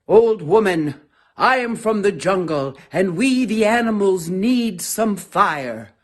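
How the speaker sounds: loud announcing antelope; calling out. Acted. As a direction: call across to the old woman. Antelope calls to old woman.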